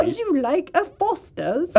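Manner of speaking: put-on high, slow droid with an Aussie accent; hammed-up prim mock Australian; C-3PO. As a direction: offer it as a prissy Australian robot butler. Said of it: "What if C three P O had an Australian accent?".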